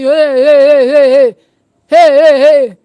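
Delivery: loud, repeated mock yells of "hey"; parent shouting. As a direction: shout it like a parent trying to stop a child. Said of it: "You are shouting".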